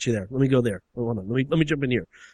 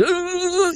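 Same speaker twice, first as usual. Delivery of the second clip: high, juddering "uh"; stuttering high electrocution noise; electric shock cry. Acted. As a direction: imitate a man being shocked. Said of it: "he's getting shocked".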